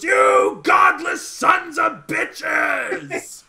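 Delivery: slow, drawn-out high bellow; furious dwarf yell. Acted. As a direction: roar it after the escaping thieves. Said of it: "And the dwarf goes".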